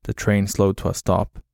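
ordinary delivery

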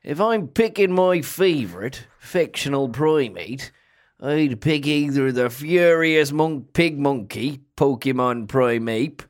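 put-on a light Yorkshire accent, unhurried. A podcast host asked for Yorkshire names his favourite apes, in a light Yorkshire accent. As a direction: talk about apes like a Yorkshire local, lightly done. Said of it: "What about a Yorkshire?".

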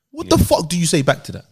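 normal talking voice

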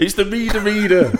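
high, insistent mock exasperation; exasperated. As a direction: insist to an investor who won't get it. Repeats pitch in exasperation.

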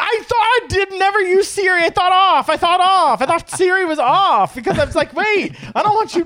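high, panicked laughing disbelief; freaked out. Real, not acted. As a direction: squeal it, spooked and laughing. Freaked out by Siri.

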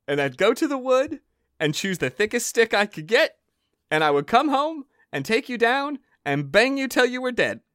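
high-pitched sing-song Molly; shrill cheeky girl voice; high girlish threat. Acted.

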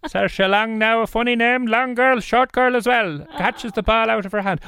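very high, sing-song Cork commentary; Cork match commentator. Shown as a demonstration. Host mock-commentating on co-host at a match, Cork accent. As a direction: call the match in squeaky Cork. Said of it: "commentating on a ga match".

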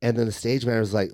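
ordinary talk, nothing marked

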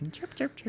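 bird chirps. Pretend. Imitating bird calls.

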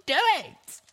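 high, loud, frantic insistence; insistent shout. Acted. Little Pip insisting Velvet cut away the mark, frantic shout. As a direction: force her to act now. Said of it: "I insisted".

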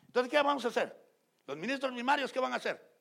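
loud, high preaching at full force. Acted. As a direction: rouse the congregation with a loud, high call. Preacher raises voice to congregation. (predicación a todo pulmón)

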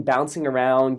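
usual speaking voice

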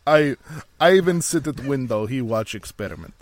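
broken-English mock Russian; bad Russian accent. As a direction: play a hammy, badly faked Russian. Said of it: "really bad Russian accent".

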